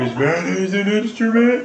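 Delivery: lightly voiced dopey starfish; Patrick voice. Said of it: "No, Patrick".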